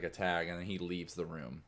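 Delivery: ordinary talk, nothing marked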